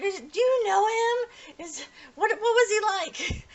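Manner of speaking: high, slow, cry-like pleading; eager pleading. Retold or imitated. Uo begging Toru to say what he was like, high and pleading. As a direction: beg for any news of him. Uo desperate to hear about Kureno.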